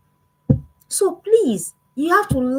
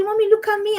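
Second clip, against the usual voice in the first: happy daughter voice; 9 semitones higher than usual. Retold or imitated. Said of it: "She's just, and she's".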